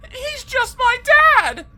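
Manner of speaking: put-on high, loud desperate girl; pleading. A narrator's reading. Helen defending her dad to the skeleton, high and loud. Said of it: "pleaded Helen".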